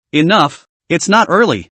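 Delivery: usual speaking voice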